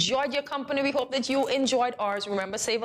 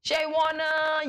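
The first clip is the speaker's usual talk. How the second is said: hyped giveaway announcement; 7 semitones higher, much slower than usual. Real, not acted. Winner announced drawn out.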